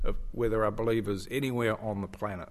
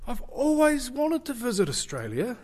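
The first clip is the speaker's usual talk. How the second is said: mock shop lady; 12 semitones higher than usual. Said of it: "She said".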